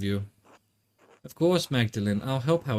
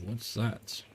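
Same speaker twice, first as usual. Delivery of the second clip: normal talking voice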